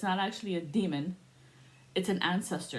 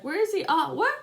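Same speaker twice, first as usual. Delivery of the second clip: much higher and louder, eager calling out; mock hunting boogeyman; 9 semitones higher, 6 dB louder than usual. Kid tracking the boogeyman, calling out high and loud. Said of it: "I was like".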